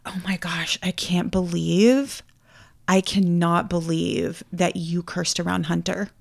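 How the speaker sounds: slow, hushed mock disbelief; softly hammed-up pearl-clutching; imitated scandalized mom. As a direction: draw out each word in quiet shock.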